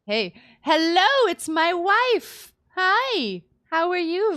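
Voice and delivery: high, loud, drawn-out hello to his wife; excited greeting. Real, not acted. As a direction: greet her like a long-lost love.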